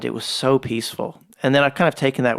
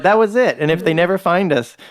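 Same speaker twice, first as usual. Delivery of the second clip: higher and louder hammed-up vow; mock dramatic threat; 4 semitones higher, 4 dB louder than usual. Man jokingly threatens his friend with a hammed-up dramatic vow. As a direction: play it as a mock last stand. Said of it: "I was like".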